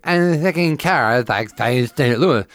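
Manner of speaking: slurred, slow and high, hammed-up drunk woman; drunk heckler voice. Comic mimics the drunk heckler at his show, high and slurring. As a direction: drag the words like a sloppy drunk shouting from the crowd. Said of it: "'cause you did that voice".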